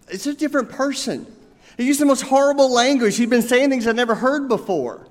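high, mock-distraught bride; hammed-up upset newlywed; teary young bride. A narrator's reading. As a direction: bride sobbing to her mother on the phone, mock-tearful. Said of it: "this young bride just started sobbing".

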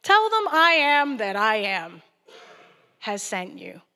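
loud, slow God voice fading at the end; God names himself to Moses, loud and slow; solemn God. Retold or imitated. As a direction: proclaim it slowly and loud. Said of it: "God's like".

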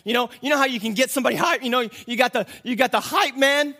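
high, loud, excited explanation; fired-up preaching. Acted. Preacher explains the hype man to the congregation, fired-up delivery. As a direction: rattle it out with rising excitement.

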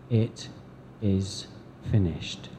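soft, slow, dying It is finished; faint final words, fading; last breath. Acted. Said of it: "gasping for the last few breaths".

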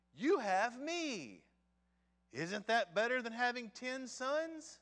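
high, loud, slow and pleased with himself; smug husband. Acted. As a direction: play the clueless husband proud of himself. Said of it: "the verse that shows his ignorance".

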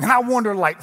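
usual speaking voice

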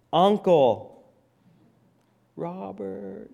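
high, drawn-out mock plea. Preacher jokes inside the servant's plea, mock begging.

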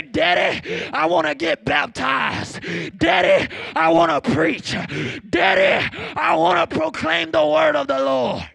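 high, loud preacher shout; full-force preaching. Real, not acted. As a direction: drive each phrase home at full volume.

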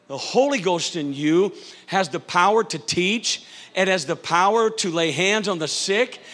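rising, shouting sermon climax; preaching at full force. Acted. Pastor whipping up the congregation, shouting. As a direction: build it up and preach it loud.

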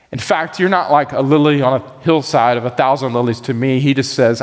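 normal talking voice

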